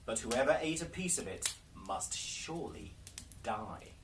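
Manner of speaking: plain reading voice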